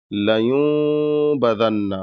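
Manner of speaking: لیوم بدن with nun blended toward meem; nasal ghunna. Shown as a demonstration. Teacher demonstrating ikhfa nasal sound, slow. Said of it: "اس طریقے سے غنہ کرنا ہے".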